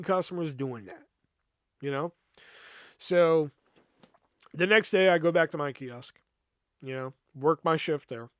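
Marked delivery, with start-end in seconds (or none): none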